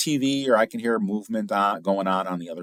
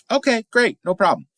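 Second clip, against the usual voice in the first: cheery agreement; 5 dB louder than usual. Retold or imitated.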